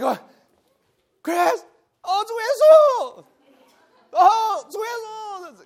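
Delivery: high, loud, joyous call of Lord Jesus; high ringing cry with a laugh; fervent calling. Retold or imitated. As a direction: show how alive the real calling was. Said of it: "It was like".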